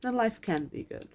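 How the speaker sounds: real near-tears; holding back tears. Real, not acted. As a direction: voice high and catching, fighting tears. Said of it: "And I'm trying not to cry right now".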